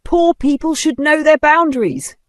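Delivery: high, loud sneering declaration; contemptuous shout. A narrator's reading. Vanessa shouting her contempt at the old woman. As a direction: proclaim it in disgust.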